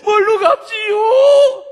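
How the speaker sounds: long drawn-out high wail; imitated bawling, stretched to the last syllable; wailing tearful prayer. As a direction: scream the question through mock sobs. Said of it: "엉엉 울면서".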